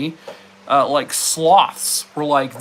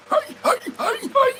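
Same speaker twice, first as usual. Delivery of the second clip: squeaky high yelps imitating a voiceless dog; hoarse dog bark. Owner imitating her dog's lost bark, squeaky yelps. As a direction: mimic a dog that barked its voice away. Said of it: "he's like".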